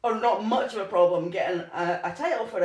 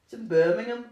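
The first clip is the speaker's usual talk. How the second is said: hammed-up Birmingham accent, laughing; mock Brummie with a giggle. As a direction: have a playful go at a Birmingham accent. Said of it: "Is that your Birmingham accent?".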